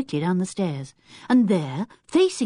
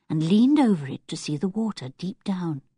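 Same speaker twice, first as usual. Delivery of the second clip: ordinary delivery, nothing marked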